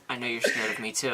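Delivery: hushed, low imitation of a whisper; soft, low confiding quote; retold whisper. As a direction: lean in and mock-whisper, amused. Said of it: "leans in and whispers".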